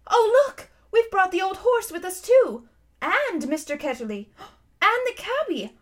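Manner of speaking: put-on high, excited astonished girl; crying out. A narrator's reading. Polly discovering who came along with them, crying out. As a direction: exclaim in mounting dismay and surprise. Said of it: "Polly cried out".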